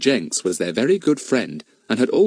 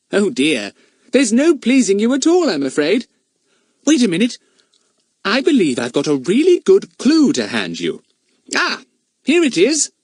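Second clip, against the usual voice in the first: put-on cheeky boy voice; 12 semitones higher, 5 dB louder than usual. Fatty teasing Goon.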